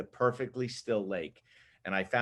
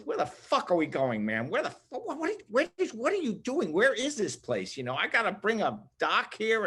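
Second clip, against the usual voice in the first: much higher, exasperated whining complaint; griping boss; 10 semitones higher than usual. Retold or imitated. Photographer griping on the hike to the rock, exasperated high whine. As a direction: whine like a fed-up boss dragged on a long hike. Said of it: "He's complaining".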